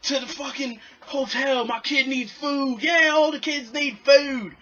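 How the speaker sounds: high, loud demanding mock tourist; impatient parent on a stuck bus, high and loud; angry tourist parent. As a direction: whine and push at the driver.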